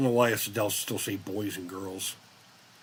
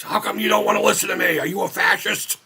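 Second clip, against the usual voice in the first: mock angry protester; 10 semitones higher, 6 dB louder than usual. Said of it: "dude just getting in your fucking face".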